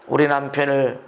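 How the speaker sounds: low, measured pronouncement; grave, controlled decree; solemn declaration. Shown as a demonstration. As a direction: pronounce protection over the family firmly. Models a declaration against problems.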